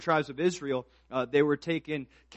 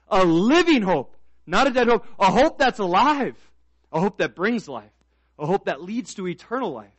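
loud, raised preaching voice; full-force preaching; 5 semitones higher, 10 dB louder than usual. Real, not acted. Preacher rousing the church as an army of soldiers, full-force preaching. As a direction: ring out each key word louder and higher.

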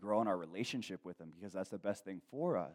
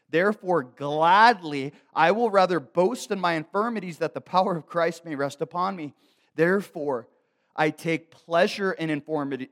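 loud, raised preacher's reading; forceful scripture reading; 6 semitones higher, 11 dB louder than usual. Real, not acted. Preacher reads Paul's words to the church, loud and forceful. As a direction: read the verse out with full force.

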